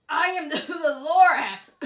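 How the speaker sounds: booming Lorax. Acted. Lorax announces himself loudly.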